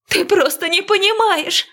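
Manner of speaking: tearful outburst. Acted. Heroine breaks down crying.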